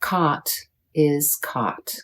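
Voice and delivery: "caught" the American way, with open ah; American "caught", jaw open on the vowel; American accent. Shown as a demonstration. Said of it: "In American accent".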